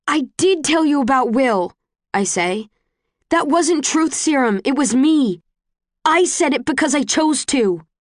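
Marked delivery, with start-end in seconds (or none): 0.1-1.7 high, emphatic rebuttal; heated protest. A narrator's reading. Tris contradicts Tobias in their quarrel, protesting hotly. 3.3-7.9 high, heated declaration; emphatic insistence. A narrator's reading. Tris insists her confession was her choice, vehement.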